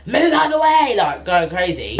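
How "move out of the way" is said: high, loud retold yell at traffic; shouting at drivers. Mum yells at drivers while racing to hospital, loud high shout. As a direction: bellow it out the car window. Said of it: "I could hear her shouting at drivers".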